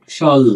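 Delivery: স্বল cut off before the প; clipped half-word. Shown as a demonstration. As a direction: say স্বল as শল and stop dead on the ল. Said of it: "হস দিলাম কারণ ওটা ব্যঞ্জন, থেমে গেছে".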